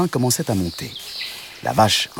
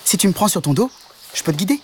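little frog voice; 11 semitones higher, faster than usual. A narrator's reading. Frog offers to guide.

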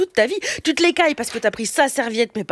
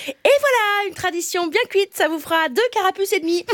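a cheerful shopkeeper, pitched higher; mock baker voice; 7 semitones higher than usual. Comedian voicing a baker at the apocalypse, chirpy mock shop voice. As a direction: play the jolly baker handing over change. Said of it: "prononcer la phrase".

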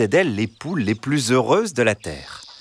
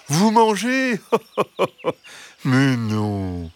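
high fox voice with a mock laugh; 4 semitones higher than usual. A narrator's reading. Fox scoffing at the hens' fear, high and amused. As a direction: laugh off the accusation sweetly.

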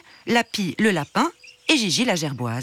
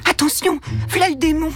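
servant's warning; 10 semitones higher, 3 dB louder than usual. A narrator's reading. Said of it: "chuchotaient les domestiques".

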